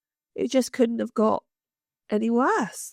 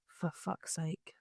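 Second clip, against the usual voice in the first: much softer, slow, under the breath; exasperated mutter; 4 semitones lower, 15 dB quieter, slower than usual. Retold or imitated. Seated next to the chatty man, muttering to herself, much softer and slow. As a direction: groan it inwardly, fed up. Exasperated thought about seatmate.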